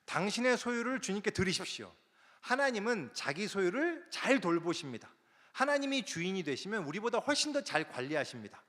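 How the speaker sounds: lightly voiced preacher urging the crowd; seminar preacher. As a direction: proclaim it like the speaker at the podium. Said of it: "세미나 강사가 그러더래요".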